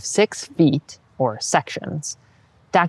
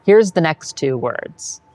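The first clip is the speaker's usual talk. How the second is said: ordinary talk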